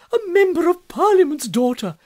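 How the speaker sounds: high, loud exclamation of delight; delighted echo. A narrator's reading. Maria repeats her sister's news, delighted. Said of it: "with a smile of delight".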